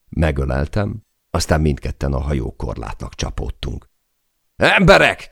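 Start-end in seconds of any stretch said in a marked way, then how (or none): 4.5-5.3 high, loud call across the deck; captain shouting for his crew. Acted. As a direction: summon the men with a loud cry. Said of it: "kiáltott Chay a legénységre".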